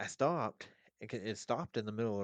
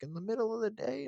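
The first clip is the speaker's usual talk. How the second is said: incredulous ex-wife; 13 semitones higher, 3 dB louder, faster than usual. Retold or imitated. Ex-wife voiced in retold story.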